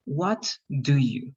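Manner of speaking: WHAT, then DO YOU, each word said in full; fully pronounced words. Shown as a demonstration. Teacher reading every word for the class, fully pronounced. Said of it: "read all the words, you have to respect".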